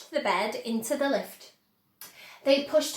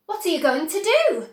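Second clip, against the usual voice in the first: a bit higher and louder, urgent; anxious cry; 5 semitones higher, 6 dB louder than usual. A narrator's reading. Charlie asking Wonka in the hovering lift, urgent. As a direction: call out the question with alarm. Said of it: "cried Charlie".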